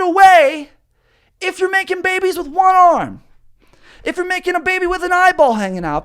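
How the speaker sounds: high, strained yelling voice, slowed at first; mock scolding. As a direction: scold him like an outraged stranger shouting across a room. Ranting at furry dude.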